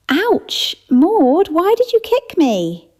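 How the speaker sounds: high, loud startled complaint after a kick; pained yelp. Acted. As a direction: yelp out, kicked under the table. Dorothy kicked under table, yelps.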